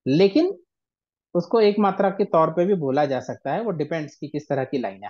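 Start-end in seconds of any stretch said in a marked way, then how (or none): none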